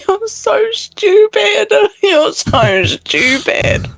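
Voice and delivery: high, drawn-out tearful wail broken by laughs; crying and laughing. Acted. Lyra scolds Jericho after shooting him, crying and laughing. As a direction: laugh through the tears, stretching the words out high. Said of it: "cry and laugh".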